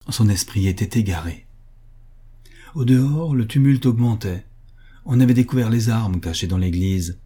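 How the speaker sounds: usual speaking voice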